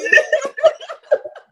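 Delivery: a high, loud laugh breaking into a cry. Real, not acted. Laughing and crying together.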